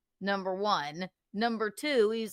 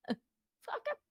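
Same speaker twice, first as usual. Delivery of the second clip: laughing squeak; 9 dB quieter than usual. Real, not acted. Laughing outburst.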